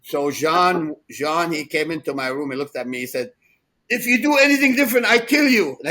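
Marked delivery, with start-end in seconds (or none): 3.9-5.8 high, loud, fast mock threat; mock threatening yell. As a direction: bark the threat half-jokingly, fast and high. Retold threat from a friend.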